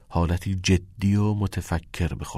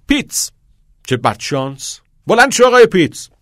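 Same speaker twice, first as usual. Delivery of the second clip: loud, high teacher calling on a pupil; high, ringing roll call; 10 semitones higher, 10 dB louder than usual. Acted. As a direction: order the pupil up in a raised voice. Teacher calls pupil to stand.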